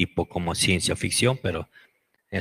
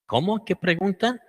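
very high squeaky exclamation; squeaky ant voices; 11 semitones higher, 4 dB louder than usual. Retold or imitated. The ants question the scientist, squeaky high exclamation.

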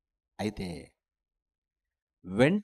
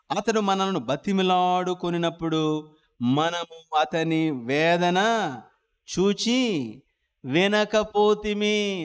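drawn-out, very slow reading of the verse; slow scripture reading; much slower than usual. Acted. Preacher reads the brothers' confession to the church, very slow. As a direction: stretch every word of the verse.

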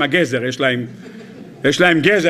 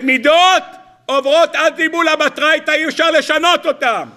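preaching at full force; 8 semitones higher than usual. Acted. Preaching punchline.

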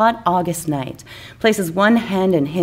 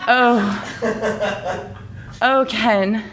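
sighing; much slower than usual. Acted. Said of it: "she sighs".

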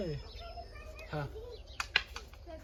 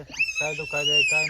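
shouts the words काय दुखायलंय high and loud, then drops for काय नाही; exasperated mock yell of the question; 4 dB louder than usual. Husband mimics his own question to his crying wife, as a mock shout.

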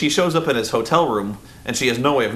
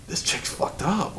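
slow, higher whisper of a shocked viewer; awed whisper; 7 semitones higher, 6 dB quieter, slower than usual. Retold or imitated. Viewer watching the finger breaking whispers in shock. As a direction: breathe it out, spooked.

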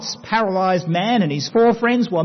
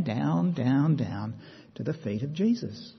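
dropping lower and softer with each down; fading downward; 5 semitones lower, 8 dB quieter than usual. A narrator's reading. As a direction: let the voice sink as the man is lowered. Man lowered slowly through roof.

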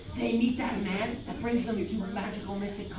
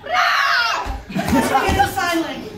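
laughing; 9 semitones higher, 6 dB louder than usual. Real, not acted. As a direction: get it out while cracking up. Cracking up while proposing.

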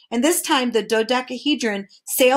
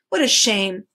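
plain reading voice

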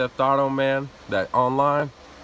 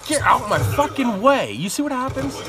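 angry at a teammate blocking him; furious; 9 semitones higher, 3 dB louder than usual. Real, not acted.

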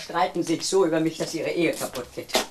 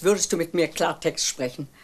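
usual speaking voice

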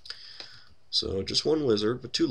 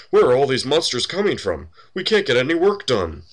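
loud, high-pitched exasperated complaint; exasperated miner; 8 semitones higher, 9 dB louder than usual. Acted. A miner complains in his ledger about monster attacks, loud exasperation. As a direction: play a fed-up worker, raising the voice.

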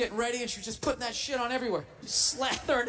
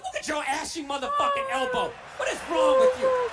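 higher, scolding yell at a friend's elbow; mock scolding friend; 10 semitones higher, 4 dB louder than usual. Friend chewing out a buddy over ashy elbows, mock scolding yell. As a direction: yell it like a friend who can't believe that elbow. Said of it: "Their friends help 'em out".

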